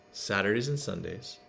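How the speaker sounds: usual speaking voice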